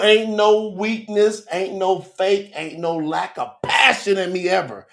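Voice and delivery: normal talking voice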